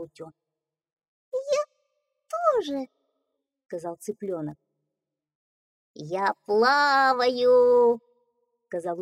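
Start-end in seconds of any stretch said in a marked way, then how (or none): 1.3-2.9 tiny squeaky chick, much higher. Acted. Chick echoes. 5.9-8.0 very slow, drawn-out duckling voice; happy duckling. Acted.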